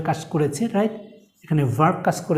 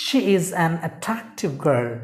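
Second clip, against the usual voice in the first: slow dictation; much slower than usual. Shown as a demonstration. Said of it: "যেমন আমরা বলতে পারি".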